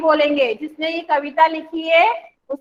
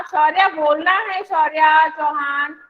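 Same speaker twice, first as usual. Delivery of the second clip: ordinary talk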